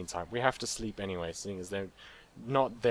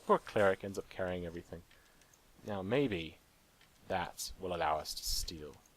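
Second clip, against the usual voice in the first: ordinary talk, nothing marked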